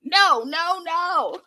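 high, loud protesting no no no; hammed-up fussy refusal; whiny mock Florian. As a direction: raise the pitch and whine a refusal. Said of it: "He says".

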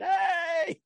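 high, long hollered hey; imitated call for help. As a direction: mimic a trapped man calling to those overhead. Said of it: "he'd hear things go overhead".

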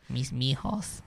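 soft, lightly voiced Mexican señora. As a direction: murmur like a doting old señora. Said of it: "my Mexican, uh, señora voice".

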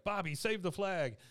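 high, urgent call across the room; frantic mother. Retold or imitated. Mom yelling to Bobby on the burning stage, high frantic call. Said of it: "She's like".

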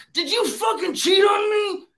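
high, slow shouted accusation as the angry wife; furious accusation. Retold or imitated. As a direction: yell it like a wife who thinks she's been cheated on. Wife furious, ready to kill.

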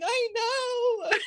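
high, loud laughing yell; excited scream. Retold or imitated. Swimmer yelling back to the beach, laughing with joy. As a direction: shout back, thrilled and laughing. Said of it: "And I'm screaming".

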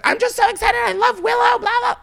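high, squealing gush of a starstruck fan; mock your own giddy fangirling; hammed-up excited fangirl squeal. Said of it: "I was like".

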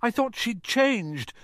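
high, slow, breaking with tears. Acted. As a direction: crack the voice on the plea. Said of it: "her voice breaking".

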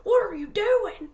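high, loud mock accusation; retold startled demand. Scratched boyfriend turns on girlfriend, startled accusation. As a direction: imitate a startled, accusing partner.

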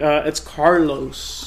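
slow, drawn-out Carlos after an "uh"; awkward, stretched-out correction; hesitant correction. Acted. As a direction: correct the name slowly. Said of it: "Would you be like".

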